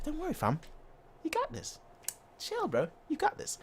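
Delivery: calm a nervous player down; a coach soothing his player, soft and easy; reassuring coach. Retold or imitated. Flick voiced reassuring.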